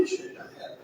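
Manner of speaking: breathless whispered ixi; whispered aside. Acted. Therapist whispers that a racing mind is lost. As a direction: drop to a whisper, resigned.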